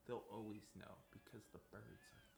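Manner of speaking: secretive conspiracy whisper. Pretend. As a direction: hush it like a secret.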